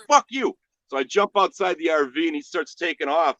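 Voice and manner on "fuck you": high, loud retold outburst; acted angry comeback. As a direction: spit the insult back at him.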